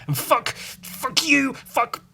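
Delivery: a comic self-directed tirade that spirals; escalating rant. Acted. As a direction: build it into a mock frenzy.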